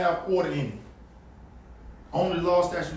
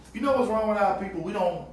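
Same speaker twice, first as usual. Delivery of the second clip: ordinary talk, nothing marked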